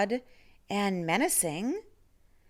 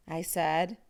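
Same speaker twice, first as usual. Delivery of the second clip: usual speaking voice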